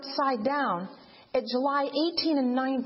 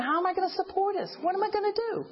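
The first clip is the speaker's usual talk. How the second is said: retold crying out; 7 semitones higher than usual. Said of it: "I've been crying out to God".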